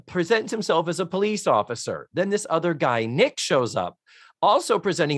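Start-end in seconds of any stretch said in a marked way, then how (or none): none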